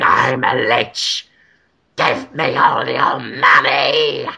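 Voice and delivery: low, slow undead lich voice; slow, low ghoulish demand. Pretend. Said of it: "It's a great lich voice".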